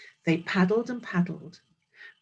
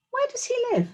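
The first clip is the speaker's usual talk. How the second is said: curious little beaver; faster than usual. Acted. Little Beaver asks eagerly.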